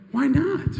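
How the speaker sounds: high, loud, incredulous retort; incredulous challenge. Retold or imitated. Star challenges director who never learned his lenses, incredulous. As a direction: throw it back high in disbelief.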